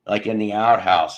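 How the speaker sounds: ordinary talk, nothing marked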